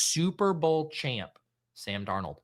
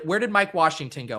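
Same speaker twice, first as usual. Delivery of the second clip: ordinary talk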